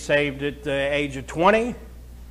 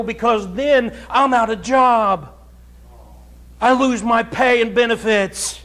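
higher, forceful pulpit voice; fiery preaching; 7 semitones higher, 4 dB louder than usual. Acted. Preacher warns the church about paid pastors, fiery. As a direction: preach it hard to the pews.

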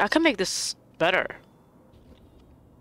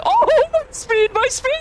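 panicked laughing; 13 semitones higher, 4 dB louder than usual. Real, not acted. Player losing speed while fleeing, high laughing panic. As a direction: squeal it while laughing helplessly.

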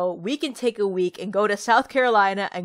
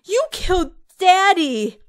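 high, loud furious shriek; a sister shrieking in rage at Emily; 12 semitones higher, 12 dB louder, slower than usual. Acted. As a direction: scream the accusation down into the grave. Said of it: "Nancy shrieked".

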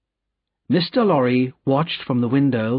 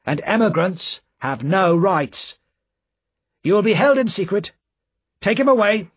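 high-pitched, sharp official's order; 8 semitones higher, 3 dB louder than usual. A narrator's reading. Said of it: "said the official sharply".

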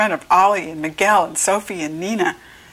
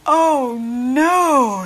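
loud, high, drawn-out groan; long wailing groan, storybook style; dismayed groan; 5 semitones higher, 5 dB louder than usual. A narrator's reading. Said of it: "Sophie groaned".

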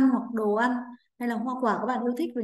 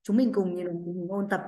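ordinary talk

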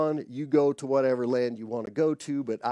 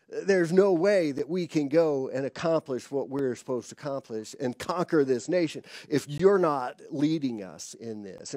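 ordinary delivery

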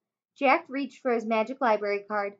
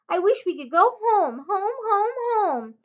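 eager wish; 9 semitones higher than usual. A narrator's reading. Annie wishing to go home.

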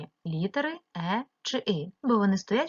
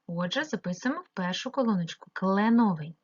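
usual speaking voice

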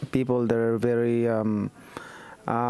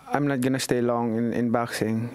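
ordinary talk, nothing marked

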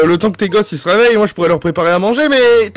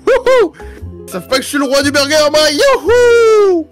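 excited cheering; 2 semitones higher than usual. Acted. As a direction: whoop it up, proud cook. Cheering while cooking.

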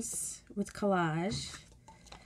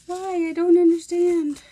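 much higher, louder, pleading disbelief; exasperated whys; 8 semitones higher, 4 dB louder than usual. Retold or imitated. To candle-lighting crafters, a higher, louder exasperated why. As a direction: throw up your hands and beg why. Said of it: "I'm just like".

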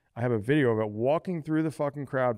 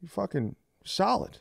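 mock impressed; 7 semitones higher, slower than usual. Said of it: "I'm like".

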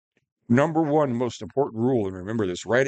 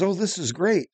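higher, mock-delighted gush; mock delighted guy; 9 semitones higher than usual. Mocking the video guy's breakfast, higher and gushing. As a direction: gush over the food like a giddy camper. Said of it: "And he goes".

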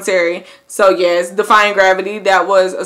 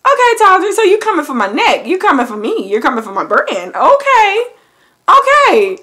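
high, sassy mock-offended reply; high sassy playful protest; 9 semitones higher than usual. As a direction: act personally called out, sassy. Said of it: "I was like".

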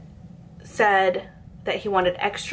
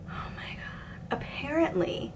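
unvoiced slow aside; hushed incredulous aside; whispered disbelief; 6 semitones higher, 11 dB quieter, slower than usual. Real, not acted. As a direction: breathe it out in shock.

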